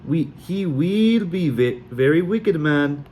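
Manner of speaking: put-on slow, high, terrible Sully accent; clumsy mock Sully fortune; bad Sully accent. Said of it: "My accent was even worse than Mal's".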